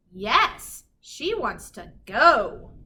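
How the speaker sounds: ordinary delivery, nothing marked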